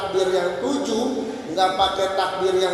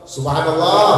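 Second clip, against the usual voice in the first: louder, insistent mock-exasperated Subhanallah; 5 dB louder than usual. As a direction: push the reminder out with mounting impatience. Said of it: "agar getan".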